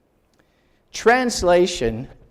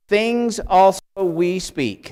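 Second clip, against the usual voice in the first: slow literal reading; 3 semitones higher, slower than usual. Shown as a demonstration. Teacher reading the literal Greek to the class word by word. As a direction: read "Things also we speak." very slowly, word by word. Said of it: "That's literally the first three words".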